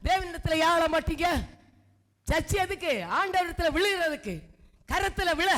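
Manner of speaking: a pastor pleading with the church to cry to God; fervent preaching. Acted. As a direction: press the crowd hard with every question. Pastor exhorts congregation fervently.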